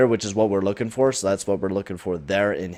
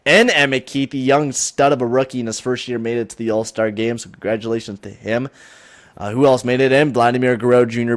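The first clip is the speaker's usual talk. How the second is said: ordinary talk, nothing marked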